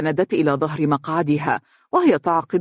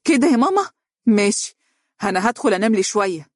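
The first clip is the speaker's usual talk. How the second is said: sharply raised pitch, indignant; agitated outburst; 5 semitones higher, faster than usual. A narrator's reading. Daughter reacting to mother's mockery with agitated indignation. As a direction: flare up, then storm off. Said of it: "وهي تقول بانفعال".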